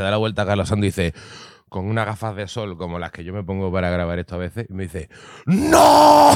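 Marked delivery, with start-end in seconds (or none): 5.5-6.3 sudden high dramatic shout of no; mock yell. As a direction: belt out a hammed-up dramatic no. Robot scene, actor yells.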